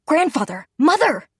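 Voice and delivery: loud, high, desperate calls; crying out. A narrator's reading. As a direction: shout after them as they're dragged away. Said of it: "Ed cried to try to stop them".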